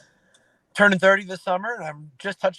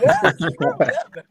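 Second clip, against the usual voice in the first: laughing agreement; 2 semitones higher, 7 dB louder than usual. Retold or imitated. Dad retelling his answer to his little girl, laughing. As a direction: crack up while agreeing.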